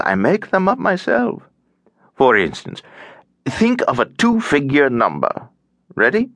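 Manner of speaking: lively, swooping voice of a chatty man; tiresome cheerful stranger. A narrator's reading. Chatty stranger tries out his new acquaintance's name, tiresomely cheerful. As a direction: play the cheery lift bore, rising and falling. Stranger proposes party game.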